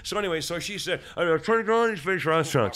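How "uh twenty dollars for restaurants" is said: unintelligible garble. Retold or imitated. Cashier behind glass he can't hear, mock garbled speech. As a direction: imitate a cashier's garbled, loud gabble. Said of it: "I couldn't hear her".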